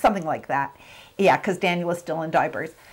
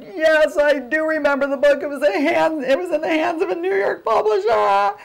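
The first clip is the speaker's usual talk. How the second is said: high, excited, rushed pride; answering God's voice, high and eager; eager reply; 9 semitones higher than usual. Retold or imitated. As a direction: proudly point to her own book. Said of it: "And I yet".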